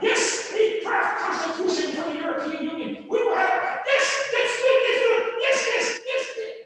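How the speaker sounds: loud, high, eager yes-men; hammed-up gushing agreement; mocking French leaders. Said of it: "and you need to talk".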